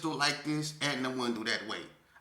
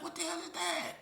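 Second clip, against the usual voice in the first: startled; 13 semitones higher, 5 dB quieter than usual. Retold or imitated. Hears strange noises outside.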